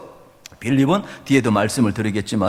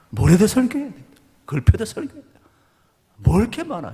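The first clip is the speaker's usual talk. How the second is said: preacher griping, pitch raised high; exasperated preaching; 10 semitones higher than usual. Acted. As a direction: vent about too many sermons from the pulpit. Exasperated preaching about workload.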